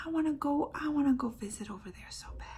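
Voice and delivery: fast hushed whisper of yearning; longing whisper. Real, not acted. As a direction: confide the wish under your breath. Longing aside after tearing up.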